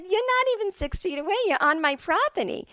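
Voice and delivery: high-pitched mock scolding; indignant mom. Mom to a dog walker on her property, indignant. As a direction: play a mother telling off a trespasser. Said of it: "my mom's like me".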